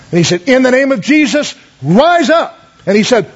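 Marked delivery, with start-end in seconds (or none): 0.5-2.6 high ringing command to rise; commanding prayer. Retold or imitated. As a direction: call her up from the bed with authority. Cody commands the dying aunt.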